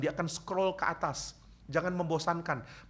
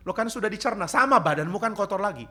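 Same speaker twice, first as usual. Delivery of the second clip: mock scolding mother; 7 semitones higher, 6 dB louder than usual. Mother arguing back.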